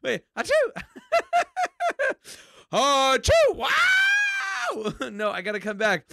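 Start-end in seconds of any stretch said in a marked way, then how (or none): 2.7-3.5 high loud theatrical sneeze; fake sneeze. 3.7-5.1 loud high laugh; high burst of laughter. Real, not acted. Laughs after sneeze.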